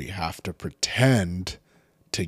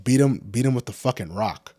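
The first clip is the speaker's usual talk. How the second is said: ordinary delivery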